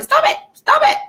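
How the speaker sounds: high-pitched pretend yelling; mock yell. Acting out a backdoor freak-out for viewers, mock high yell. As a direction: squeal it like a comic backyard freak-out. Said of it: "in the backyard yelling".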